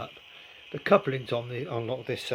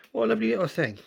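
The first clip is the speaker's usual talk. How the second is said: 1 delighted exclaim; 9 semitones higher, 6 dB louder, faster than usual. Real, not acted. Delighted at the toy find.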